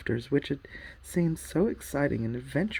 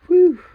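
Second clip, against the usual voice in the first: loud, high whoosh of relief; relieved whew; 17 dB louder than usual. A narrator's reading. Polly after the witch leaves, loud relieved whew. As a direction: blow out a big relieved whew. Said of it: "a long breath of relief".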